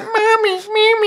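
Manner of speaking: put-on very high whiny little-kid cry; baby voice. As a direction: wail for mommy like a toddler. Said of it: "crying "mommy" in the baby voice".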